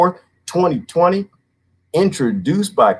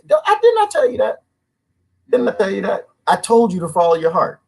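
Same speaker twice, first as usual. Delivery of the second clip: mock scolding; 10 semitones higher than usual. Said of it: "I said".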